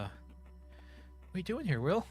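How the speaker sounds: put-on high, slow frightened girl's voice; frightened Martha. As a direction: stammer it out like a scared classmate. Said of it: "Martha looks frightened".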